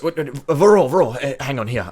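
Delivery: high, loud, stammered interruption; eager little official piping up; flustered gatekeeper. Acted. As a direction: call them back in a fluster. Gate guy stops the group.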